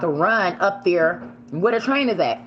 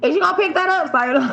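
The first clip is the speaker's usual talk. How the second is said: high, louder sassy challenge; sassy callout; 14 semitones higher, 4 dB louder, faster than usual. Retold or imitated. Watching a dog owner, high sassy demand. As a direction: call out a neighbor over dog mess. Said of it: "Be watching them like".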